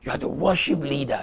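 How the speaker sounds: ordinary talk, nothing marked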